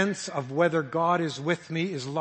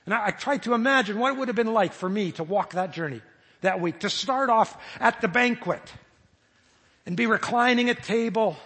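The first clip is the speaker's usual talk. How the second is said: ordinary talk, nothing marked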